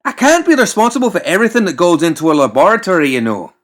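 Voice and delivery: high, loud, fast exasperated rattle; exasperated rant. Acted. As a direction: rush the excuse out in a huff. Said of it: "getting exasperated".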